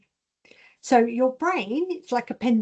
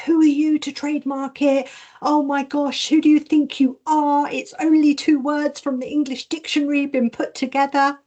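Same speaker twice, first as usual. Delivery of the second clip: mock-outraged self-doubt, pitched up; 4 semitones higher than usual. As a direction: scoff at yourself as the doubting inner voice. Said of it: "My lower self was like".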